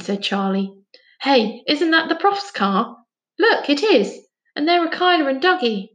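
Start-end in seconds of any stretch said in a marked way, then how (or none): 1.2-5.9 put-on rising higher, excited boy; excited discovery. A narrator's reading. Boy spotting his friends in the car park, excited. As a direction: delight at seeing everyone.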